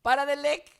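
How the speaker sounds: high, loud Spanglish order; dog scolding. Acted. Owner ordering dog to stop licking, high loud scolding. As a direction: shout the dog off.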